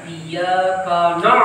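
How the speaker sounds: Iyyaka na all thick and heavy, slow; heavy nun and ain. Shown as a demonstration. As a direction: make everything heavy through na. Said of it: "Menebalkan huruf ain pada na'budu". (kaf, nun dan ain semua tebal)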